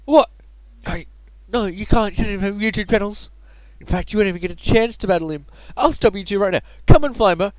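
high, loud heroic defiance; defiant kid hero. Acted. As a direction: shout it like a young hero.

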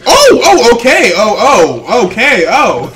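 high, loud flustered okays; startled by the yelling video, loud and high. Real, not acted. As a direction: back off, rattled and loud.

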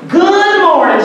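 high, sing-song Good evening; grandma's greeting. Retold or imitated. Grandson imitating grandma's sunny phone greeting. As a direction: lift up on your toes and sing the greeting. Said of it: "got up on her toes while she".

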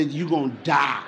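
low, soft echo of grandma; grandmother's voice in his head. Retold or imitated. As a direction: recall her warning quietly. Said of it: "my grandmother's voice say".

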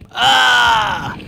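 sharp startled scream of pain. Real, not acted. Fish player yelping as the whale bites. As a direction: yelp as the whale strikes.

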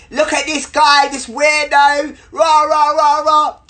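high, loud put-on ranting; mocking girl imitation. Girl filming him on her phone, scornful mimicry. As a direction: jeer it high, trailing into loud blather.

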